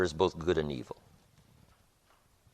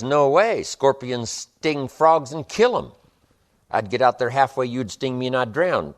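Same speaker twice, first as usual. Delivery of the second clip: frog voice; 6 semitones higher, 6 dB louder than usual. Acted. Frog refusing the scorpion.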